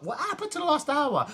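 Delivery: high-pitched, mock bewildered question; baffled phone user. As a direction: voice someone suddenly puzzled by lost time. Acts out losing track of time.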